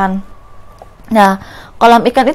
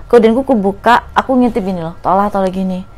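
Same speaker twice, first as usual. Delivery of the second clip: normal talking voice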